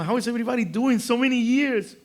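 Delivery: high, beaming hello; cheery greeting. Retold or imitated. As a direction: greet them with a big smile. Cheerful greeting, big smile.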